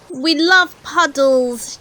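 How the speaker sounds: put-on slow, loud children's shout. A narrator's reading. Children shouting their love of puddles, slow and loud. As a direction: shout it gleefully. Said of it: "shouted the children".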